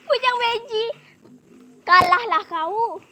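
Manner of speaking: high cackle into a loud taunt; laughing, high-pitched triumphant jeer; gloating laugh. Acted. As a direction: crow over your beaten rival. Tickler laughs and crows over rival.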